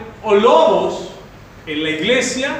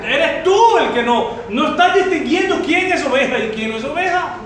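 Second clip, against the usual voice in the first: higher, louder fiery sermon rebuke; full-force preaching; 8 semitones higher, 4 dB louder than usual. Acted. Pastor scolding the congregation for choosing wolves, in a fiery, raised preaching voice. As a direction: thunder it at the flock.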